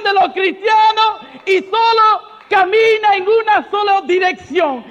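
shouted, driving sermon, loud on every phrase; preaching at full force. Acted. Preacher shouting to the charismatic assembly, full-force preaching.

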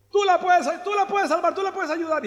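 high and loud, begging; desperate plea. Acted. Mother begging Jesus to heal her daughter, loud and high. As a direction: insist he can save her.

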